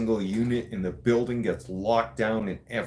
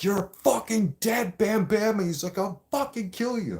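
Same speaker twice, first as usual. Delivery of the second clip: much higher pitched, slowed furious threat; screaming threat; 11 semitones higher than usual. Retold or imitated. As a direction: yell it through the cell door. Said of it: "he started screaming and yelling".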